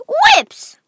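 a very high, loud shriek of the word; shocked cry. Acted. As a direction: yelp it in disbelief. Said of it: "cried Veruca Salt".

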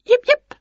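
tiny, very high yelps; animal yips. A narrator's reading. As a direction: yip like a small wild creature. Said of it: "came a noise from the woods".

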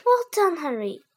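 a touch of proud praise; mild praise. A narrator's reading. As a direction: praise the boy gently. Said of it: "said Dan".

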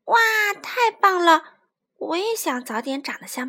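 put-on loud, excited little-child voice; childlike awe and delight; admiring baby dinosaur. Baby admires father's roar.

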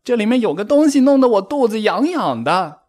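high, loud ticklish squeal; agitated high-pitched cry; frantic complaint. A narrator's reading. Rabbit frantic from tickling in costume.

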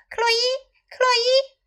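very high call of a name; high-pitched storybook calling. A narrator's reading. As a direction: call out for a friend. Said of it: "他叫道".

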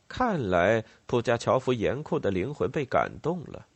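ordinary delivery

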